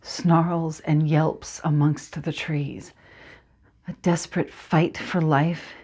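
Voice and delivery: slow, hushed storytelling; soft, tense recital; dramatic monologue narration. Acted. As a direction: draw the listener into the dark orchard. Performs dramatic monologue opening.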